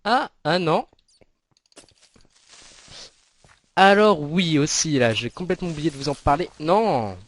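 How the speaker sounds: real startled outburst at the creeper; sudden alarm. Real, not acted. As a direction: blurt it out as it hits you. Suddenly remembers, then creeper attack.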